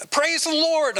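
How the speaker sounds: high, loud excited shout of praise; desperate praise. Acted. Man on a stalled boat shouting the magic command, high and loud. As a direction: yell it to start the runaway boat.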